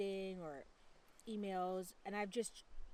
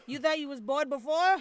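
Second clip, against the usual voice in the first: playful loud teasing; 6 semitones higher, 13 dB louder than usual. Pretend. Mock-boasting about the baby.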